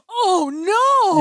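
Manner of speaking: sudden alarm at the news. Real, not acted. Realizing Moss is at podium.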